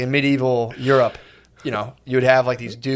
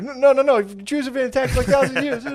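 much higher, insistent mock protest; high-pitched protest; 12 semitones higher than usual. Imitating Weinstein objecting to the panel, high and insistent. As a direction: squeak out the denial in a mock voice. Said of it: "Weinstein was like".

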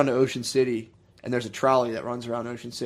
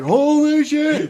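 high, loud, drawn-out panicked yell; startled yell; 13 semitones higher, 8 dB louder, much slower than usual. Retold or imitated. Retelling the flambe accident to friends, startled yell. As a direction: jump back from the flames. Hash tincture catches fire.

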